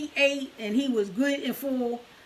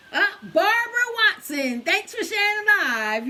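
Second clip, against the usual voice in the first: excited winner announcement; 8 semitones higher, 5 dB louder than usual. Real, not acted. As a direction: announce the winner with a big lift. Announces the prize draw winner.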